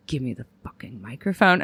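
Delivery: low, slurred mock drunk lady; drunk demand. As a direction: growl it like a wasted barfly. Drunk woman demands mic.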